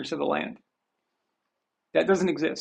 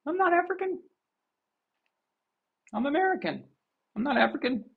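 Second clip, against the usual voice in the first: imitating Morgan Freeman, higher; 9 semitones higher, 4 dB quieter than usual. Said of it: "He's like".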